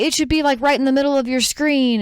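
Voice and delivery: retold scammer trying to stay patient; imitated insistent caller. Scammer guiding mom, patience thinning.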